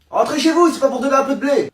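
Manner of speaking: loud, indignant shout; angry telling-off. Acted. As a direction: yell it at the tourists. Angry local scolding tourists.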